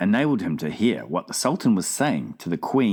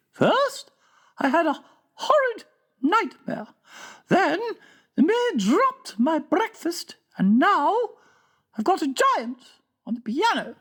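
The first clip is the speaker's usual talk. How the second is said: a grand queen explaining patiently, pitched way up; regal Queen. A narrator's reading. Queen tells the King about giants, high and stately. Queen lists her bad morning.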